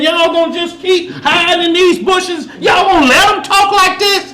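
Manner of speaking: rising to a real shout at the hiding army; rallying shout. Retold or imitated. David shouting at the soldiers hiding in bushes, rising shout. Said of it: "He said".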